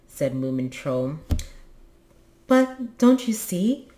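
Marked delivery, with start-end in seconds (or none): 2.5-3.9 slow, raised, earnest question; high-pitched earnest urging; serious. A narrator's reading. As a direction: press the point seriously, drawn out. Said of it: "said his mother seriously".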